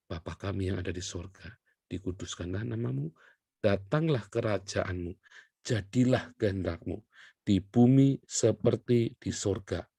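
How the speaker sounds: hushed recited Lord's Prayer; prayer recitation. Acted. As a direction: pray it reverently, unhurried.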